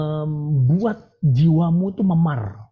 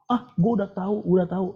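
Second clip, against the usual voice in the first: higher-pitched dismissive know-it-all imitation; mock know-it-all; 4 semitones higher than usual. Preacher mimicking people who won't listen, mock know-it-all. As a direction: mimic someone brushing off advice as already known. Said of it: "jangan sok tahu".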